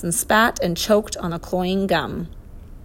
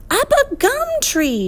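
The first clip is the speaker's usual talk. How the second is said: very high-pitched shriek; high, urgent bird screech; 4 dB louder than usual. A narrator's reading. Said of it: "shrieked the wiffle bird again".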